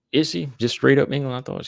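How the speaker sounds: surprised. Real, not acted. Reacting to a viewer's correction.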